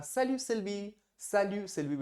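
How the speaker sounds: friendly greeting. Pretend. Greeting a friend named Sylvie cheerfully. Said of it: "आपने कहा".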